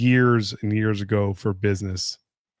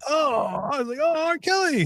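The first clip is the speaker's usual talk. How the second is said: starstruck surprise. Retold or imitated. Retold realizing who the celebrity is.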